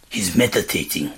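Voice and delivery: put-on low, soft French-accented hush; whispered French accent. A narrator's reading. Frenchman explaining his meditating roommate, hushed French accent. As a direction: confide it quietly in a French accent. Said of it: "whispered the man, still with a French accent".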